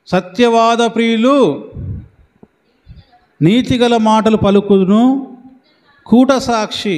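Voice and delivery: slow, lifted verse recitation; solemn slow scripture reading. Acted. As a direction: intone the proverb slowly for the church. Reads the proverb verse aloud.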